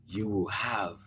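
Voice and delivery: soft soothing. A narrator's reading. Mother soothing child.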